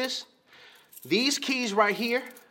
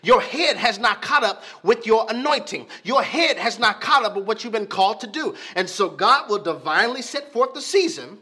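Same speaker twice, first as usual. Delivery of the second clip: louder, driving sermon voice; preaching as God; 5 dB louder than usual. Acted. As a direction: preach God's word to the congregation.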